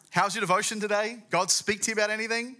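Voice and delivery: high, loud, chirpy questioning; mock wifely check-in; imitating Noah's wife. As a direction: play the keen wife prying at breakfast. Noah's wife questioning him at breakfast.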